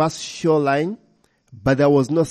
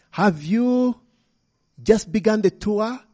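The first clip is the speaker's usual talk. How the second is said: put-on curious child; 6 semitones higher than usual. A narrator's reading. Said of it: "she questioned".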